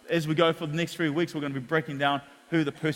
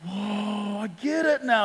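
high, amazed "whoa"; young believer getting it at last, amazed; mock amazed realisation; 4 semitones higher, slower than usual. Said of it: "I was going".

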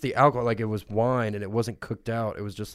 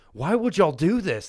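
higher, louder accusing whine; mock indignant; 8 semitones higher, 6 dB louder than usual. Confronting the food truck staff, mock indignant whine. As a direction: demand answers from the truck. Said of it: "I went up to the food truck, and I was like".

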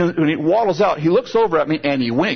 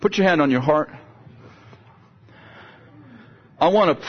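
preaching prayer; 3 semitones higher than usual. Acted. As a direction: lead the congregation in heartfelt prayer. Pastor prays over congregation.

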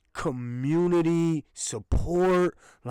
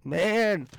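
ordinary talk, nothing marked

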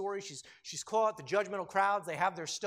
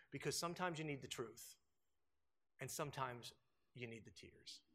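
fading hush; 7 semitones lower, 10 dB quieter than usual. Real, not acted. Tender sermon point.

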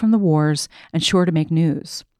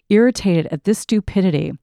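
ordinary delivery, nothing marked

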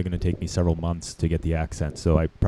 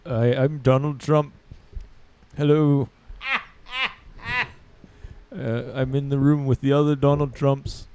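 a lightly voiced Trump, higher and slow; Donald Trump impression; 5 semitones higher than usual. Comic playing Battleship, attempting a weak Trump voice. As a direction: try to sound like Trump and miss. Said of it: "I can't do Donald Trump".

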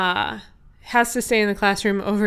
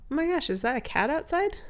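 concerned passerby; 6 semitones higher, 5 dB quieter, faster than usual. Acted. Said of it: "someone eventually is like".

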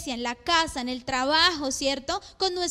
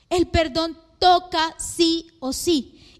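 slow, every word weighted; slow emphatic insistence; slower than usual. Real, not acted. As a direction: lay down "El perdón toca sí o sí" slowly as a rule. Preacher insists forgiveness is obligatory. (con énfasis lento y firme)

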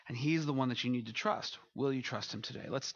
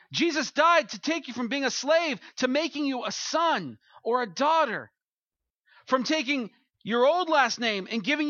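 preaching at full force; 10 semitones higher, 7 dB louder than usual. Acted. Pastor warning the congregation, building fervent preaching. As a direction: press the warning home from the pulpit.

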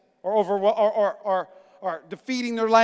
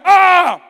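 startled yell; 7 semitones higher, 8 dB louder than usual. Retold or imitated. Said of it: "And he went".

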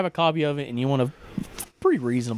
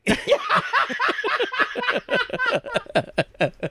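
high howling laughter. Real, not acted. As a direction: crack up hard. Big laugh during impression.

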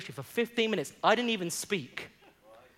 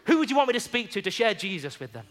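louder, rousing sermon delivery; preaching at full force; 4 semitones higher, 6 dB louder than usual. Acted. Pastor rallying the congregation to evangelize, rousing preaching. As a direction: stir the church to go and witness.

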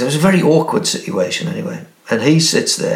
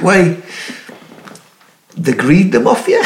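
laughing; 6 semitones higher than usual. Real, not acted. Laughing while quoting himself.

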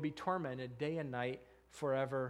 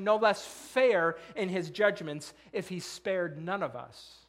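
forceful preaching; 3 semitones higher, 6 dB louder than usual. Acted. Preacher urging the congregation to ask the right question, forceful preaching. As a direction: build the no less list with rising force.

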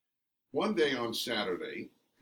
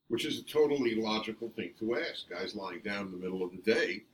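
ordinary delivery

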